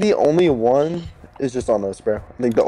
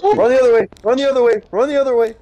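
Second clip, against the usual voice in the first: yelling a warning, high and loud; urgent shouting; 11 semitones higher, 3 dB louder than usual. Real, not acted. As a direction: shout it out in a panic. Warning friend about monster.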